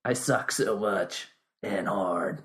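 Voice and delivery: low, slow, soft growl; deep trailer voice. Pretend. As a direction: drag it out like a movie trailer. Joking reply in put-on voice.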